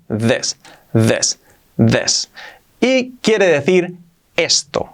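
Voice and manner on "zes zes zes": zes with voiced z and short e vowel. Shown as a demonstration. Teacher drilling how to say this. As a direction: say zes, buzzing the z. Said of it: "se pronuncia como una E".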